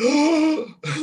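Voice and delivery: high, sharp mock gasp; startled gasp. Nervous drummer as the song starts, startled gasp. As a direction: gasp in sudden panic.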